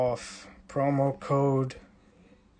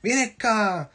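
family calling; 5 semitones higher, slower than usual. Retold or imitated. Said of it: "So they used to say".

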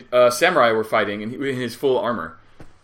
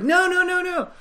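mock refusal; slower than usual. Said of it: "I'm like".

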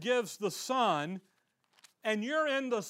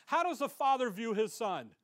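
ordinary talk, nothing marked

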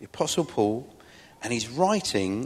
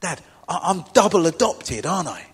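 put-on child's question; 5 semitones higher, 7 dB louder than usual. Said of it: "And she said".

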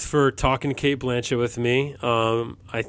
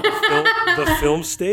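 mock question on "The Film Stage?", high and rising; exaggerated question tone; 10 semitones higher than usual. Shown as a demonstration. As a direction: laughingly replay "The Film Stage?" as an uncertain question. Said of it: "said that like a question".

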